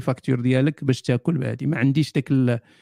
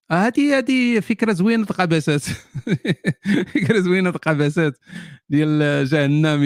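laughing through the words; amused laugh; can't hold back the laugh. Real, not acted. Laughing at the idea.

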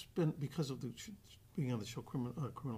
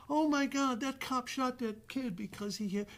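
much higher, indignant gasp; mock outrage; 9 semitones higher, 4 dB louder than usual. The public reacting to a police shooting, mock outrage. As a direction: imitate the public's shocked reaction.

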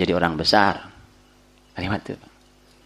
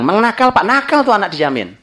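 mock complaining parent; 10 semitones higher, 4 dB louder than usual. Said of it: "Itu anak dibilang".

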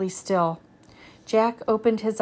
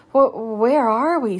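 stammering; 6 dB louder than usual. A narrator's reading. Boy landing somewhere strange, stammering. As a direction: stammer out, louder. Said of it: "stammered Jack".

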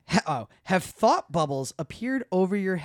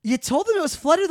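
higher, louder incredulous question; disbelieving; 12 semitones higher, 6 dB louder than usual. Retold or imitated. Retelling a call with her friend, incredulous. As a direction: ask it like you can't believe it. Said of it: "and I'm like".

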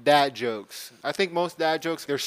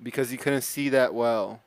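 ordinary talk, nothing marked

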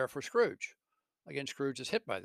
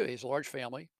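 usual speaking voice

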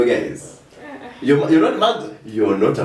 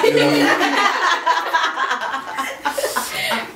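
loud high-pitched burst of laughter; 3 dB louder than usual. Real, not acted. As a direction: crack up loudly.

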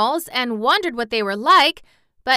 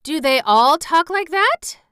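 wondering; 9 semitones higher, 7 dB louder than usual. Acted. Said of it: "asked Dorothy wonderingly".

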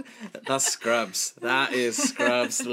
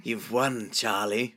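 ordinary delivery

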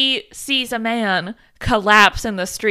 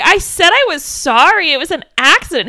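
defensive apology; 7 semitones higher, 8 dB louder than usual. Retold or imitated. Retold waitress defending herself in quarrel.

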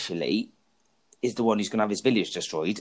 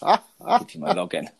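slow, higher teasing echo; mocking repeat; 7 semitones higher, slower than usual. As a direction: drag it out like you're mocking him. Teasing repeat of his line.

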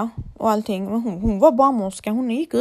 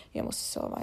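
tired whisper; 11 dB quieter than usual. Real, not acted. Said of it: "Jag börjar fan få hallisar".

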